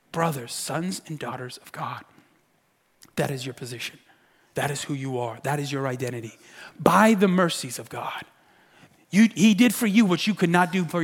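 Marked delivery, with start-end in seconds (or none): 0.1-8.2 very soft, low, deliberate reassurance; tender affirmation. Acted. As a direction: drop to a hush and let each phrase settle. Preacher hushes to affirm congregation's identity.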